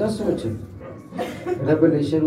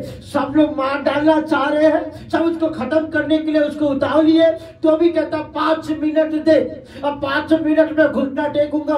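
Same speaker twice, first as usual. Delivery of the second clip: high, loud, sustained pulpit cry; impassioned preacher acting out a defiant martyr; full-force preaching; 10 semitones higher, 3 dB louder than usual. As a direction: drive each phrase home at a raised pitch.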